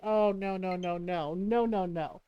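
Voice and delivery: low, soft string of noes; sinking, hushed mock dread; dismayed. Mom hearing about Trapped Princess, dismayed.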